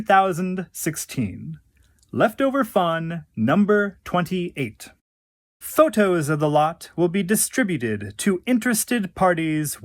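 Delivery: crisp auction-house announcement; auction catalogue read. Acted. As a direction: announce it like a lot at Christie's. Reads an auction catalogue entry.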